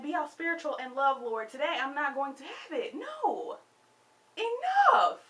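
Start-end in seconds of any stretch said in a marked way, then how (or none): 2.9-5.2 much higher and louder, a sharp final no; angry enough. Real, not acted. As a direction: slam the door on it. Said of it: "I'm angry about it".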